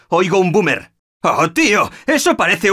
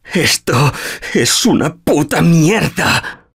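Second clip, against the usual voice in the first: normal talking voice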